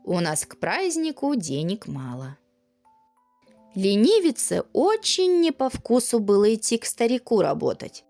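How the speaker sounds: ordinary delivery, nothing marked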